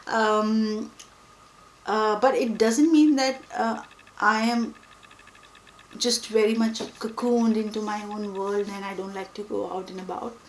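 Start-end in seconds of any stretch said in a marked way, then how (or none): none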